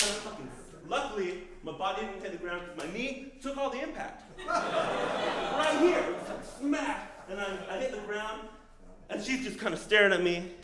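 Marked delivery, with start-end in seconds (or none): 4.3-5.5 high giggle. Real, not acted. Laughing at his fall.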